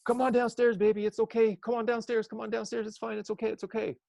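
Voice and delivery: high, sing-song coaxing; mother coaxing her shy daughter downstairs, high and soothing. Retold or imitated. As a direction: call gently up the stairs to a shy child.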